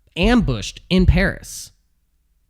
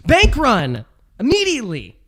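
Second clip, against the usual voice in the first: much higher, louder frantic TV stock pundit; Jim Cramer impression; 8 semitones higher, 4 dB louder than usual. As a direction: shout it like a panicked market pundit on air. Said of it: "Jim Cramer said that".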